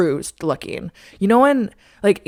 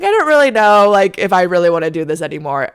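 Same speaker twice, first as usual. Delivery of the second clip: 1 pretend chill; 7 dB louder than usual. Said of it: "pretend act so chill".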